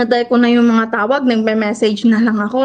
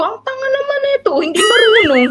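mock scorn joke; 9 semitones higher than usual. Joking to herself about the thieves, mocking scorn. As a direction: poke fun at the clueless intruders. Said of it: "Nag-joke pa nga ako".

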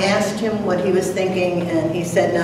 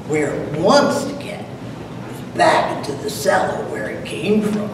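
poem reading. Acted. Reads Frost's poem aloud.

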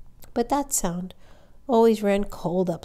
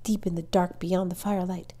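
ordinary delivery, nothing marked

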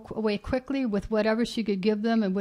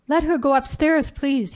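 louder, higher firm demand; simulated authority; 5 semitones higher, 6 dB louder than usual. A narrator's reading. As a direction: put on an air of command. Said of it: "as much an air of authority".

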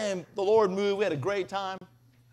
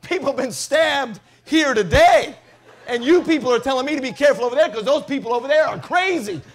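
loud, laughing outrage; laughing exasperated rant; exasperated disbelief; 4 semitones higher, 10 dB louder than usual. Real, not acted. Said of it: "Are you kidding me?".

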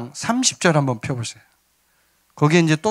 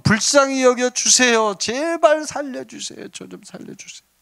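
pleading like blind men; 5 semitones higher than usual. Acted. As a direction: beg desperately for mercy, stretching the words. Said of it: "이 사람들처럼".

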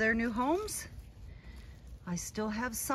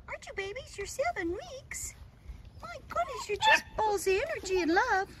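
put-on baby talk to puppies; 9 semitones higher than usual.

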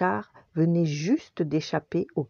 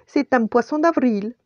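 higher, louder playful cry; calling out; 8 semitones higher, 5 dB louder than usual. A narrator's reading. Chef teases the waiting dog, calling out. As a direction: call it out as a teasing joke. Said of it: "cria le chef".

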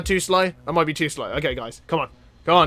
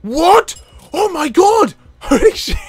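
much higher and louder startled outburst; shocked shout; 12 semitones higher, 7 dB louder than usual. Real, not acted. Player stunned by a sudden game event, loud shocked shout. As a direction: yelp in disbelief at what just happened.

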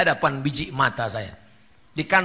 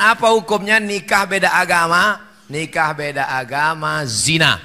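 ordinary talk, nothing marked

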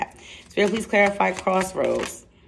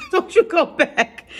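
much higher, slower sing-song warning with a laugh; mock scold; 10 semitones higher, slower than usual. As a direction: playfully scold like an auntie. Scolding viewer about going back.

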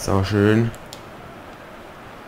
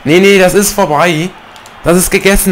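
excited; 8 semitones higher, 5 dB louder than usual. Real, not acted. Gamer sure the win is safe.

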